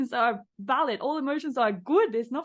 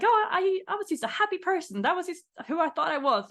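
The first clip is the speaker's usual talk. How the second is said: mock cheery high voice, imitating a client; high, chirpy denial of any problem; light mock of a self-declared happy person; mocking quote; 8 semitones higher than usual. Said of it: "I was laughing because you're like".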